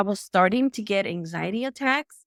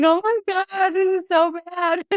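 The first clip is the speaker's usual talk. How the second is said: high, loud mock panic through laughter; laughing mock panic; 10 semitones higher, 4 dB louder, slower than usual. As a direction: laugh while acting out their panic. Retelling family panic, laughing.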